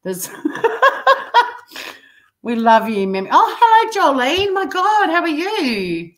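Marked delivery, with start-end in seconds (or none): 3.3-6.1 excited greeting. Real, not acted. Greeting a new chat arrival.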